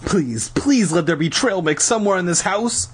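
high, pleading prayer; praying. A narrator's reading. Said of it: "I prayed".

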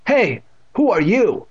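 high, loud, slow challenge; demanding. A narrator's reading. Stranger grabs boy's shoulder from behind, demanding. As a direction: demand to know, drawing out the words. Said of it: "a voice demanded".

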